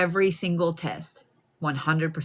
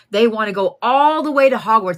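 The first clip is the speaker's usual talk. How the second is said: higher and louder, thrilled about going all the way; excited; 5 semitones higher, 9 dB louder than usual. Retold or imitated. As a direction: lift the repeat to a higher, louder, more thrilled pitch. Repeats the Hogwarts line, more eager.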